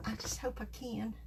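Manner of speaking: whispered under her breath; quietly hoping it works out; hopeful whisper. Real, not acted. Worried she misaligned stamp.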